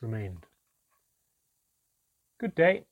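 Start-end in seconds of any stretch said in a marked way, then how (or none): 2.4-2.8 louder, higher greeting called out; mother calling out in an assumed voice; strange put-on voice. A narrator's reading. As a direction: call out in an unfamiliar assumed voice. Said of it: "in a strange voice that I'd never heard her use".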